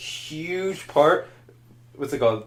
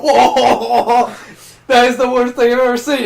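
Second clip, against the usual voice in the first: higher, louder, laughing outrage; mock outrage; 9 semitones higher, 4 dB louder, slower than usual. Reacting to the spoiler, higher laughing outrage. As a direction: act shocked at the spoiler. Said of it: "I was like".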